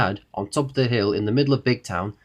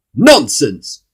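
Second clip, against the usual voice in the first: loud, higher, curt dismissal; rude; 4 semitones higher, 12 dB louder than usual. A narrator's reading. Mister Uppity interrupting the king, rude outburst. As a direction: cut in rudely and loudly. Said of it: "said Mister Uppity rudely".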